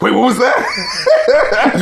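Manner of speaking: slow, high, laughing double take; high and slow, half laughing; mock double take. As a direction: act like something just slipped past under you. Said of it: "looking down and being like".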